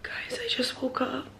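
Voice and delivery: soft, slow, breathless whisper. Real, not acted. Just woke up, whispering to her viewers. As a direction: whisper so no one wakes.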